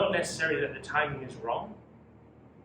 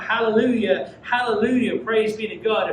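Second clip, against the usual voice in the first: louder, higher cries of hallelujah; joyful crowd praise; 5 semitones higher, 9 dB louder than usual. Acted. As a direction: cheer it like a festive crowd. Crowd's earlier praise voiced.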